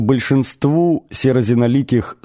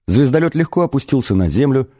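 ordinary delivery, nothing marked

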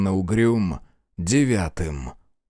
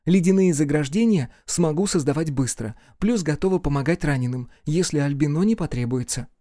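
female mage voice; 5 semitones higher than usual. Acted. Female mage reports to commander.

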